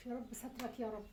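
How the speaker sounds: hushed, frantic pleading to God; soft desperate murmur to heaven; whispered panicked prayer. Acted. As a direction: pray under your breath in dread. Panicked mother prays under breath.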